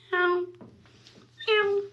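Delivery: high, loud meow at the cats; imitated meow. Real, not acted. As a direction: call the kittens with a meow. Meowing at the cats.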